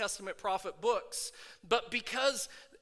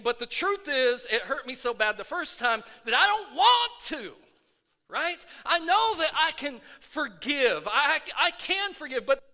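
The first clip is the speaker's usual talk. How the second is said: louder, rising preacher's fervor; preaching at full force; 6 semitones higher, 10 dB louder than usual. Acted. Pastor firing up his church, preaching at full force. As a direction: stir the congregation up, preaching hard.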